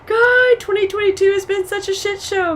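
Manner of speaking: high, loud despairing cry of God, then a shaky complaint; retold crying wail. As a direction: wail it out as if in tears that morning. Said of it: "freaking out and crying this morning going".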